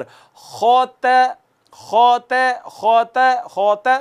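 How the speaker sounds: खोत with a heavy ख, then lips drawn back for a thin त; heavy ख, thin त, lips pulled back; light ta after heavy kha. Shown as a demonstration. Teacher drilling खोत with a thin ta. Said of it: "होंठ पीछे कर लेंगे".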